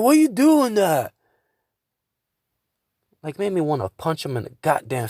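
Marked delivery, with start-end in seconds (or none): 0.0-1.1 high, loud, drawn-out imitation of outrage; mock angry. Friend mad at him at GameStop, imitated angry scolding. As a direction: imitate an indignant scolding, pitched way up. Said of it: "he'll get mad at me".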